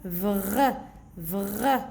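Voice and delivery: "Vr" with a long throaty R; blended consonants. Shown as a demonstration. Teacher reading syllables for children, blended consonants. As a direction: sound out "Vr" as one blend. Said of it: "regardez comment je vais lire".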